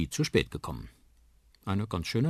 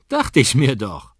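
loud, slow and knowing; exclaiming; 6 semitones higher, 12 dB louder, slower than usual. Acted. Papa had suspected the move, loud knowing exclamation. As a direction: call it out, having guessed it already. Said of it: "ruft Papa".

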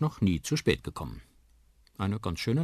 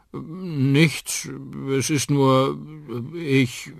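halting, higher voice with hesitant breaks; faltering; 8 semitones higher, 7 dB louder, much slower than usual. Acted. Uncle evades mama's worried question, faltering. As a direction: stall between phrases, unable to get it out. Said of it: "Onkel Danny stockt".

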